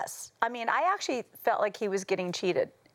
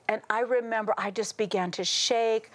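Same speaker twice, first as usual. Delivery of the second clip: usual speaking voice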